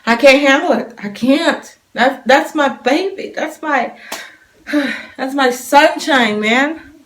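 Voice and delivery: choked-up and loving; tearful. Retold or imitated. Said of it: "I was like".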